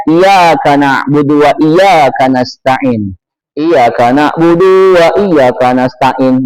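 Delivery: "iyyaka na'budu wa iyyaka nasta'in" low and slow with a single y; missing tasydid. Shown as a demonstration. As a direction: recite "iyyaka na'budu wa iyyaka nasta'in" letting each y slip undoubled. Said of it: "Nggak boleh dibaca".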